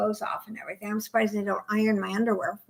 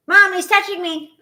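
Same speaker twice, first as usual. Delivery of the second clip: put-on high, loud childish tattle; whiny tattling little sibling; 8 semitones higher, 9 dB louder than usual. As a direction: whine to mom like a tattling kid. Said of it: "it's a typical".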